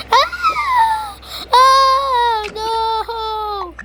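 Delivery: high far-off pretend cry; pretend alarm. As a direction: cry out in mock dismay.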